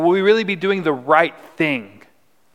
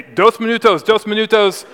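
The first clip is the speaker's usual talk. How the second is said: higher, louder hurried-plea imitation; retold calling out; 6 semitones higher, 5 dB louder than usual. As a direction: call it out as if placating someone yelling. Pleading with man yelling at him.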